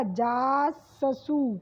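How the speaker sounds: जाससू said very slowly, ऊ on the last स; wrong pronunciation shown. Shown as a demonstration. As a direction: drag out जाससू with ऊ at the end. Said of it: "ये गलत हो जाएगा". (जाससू — धीरे, आखिर वाले स पे ऊ लगाकर)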